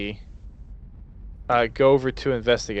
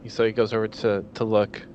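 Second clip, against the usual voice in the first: normal talking voice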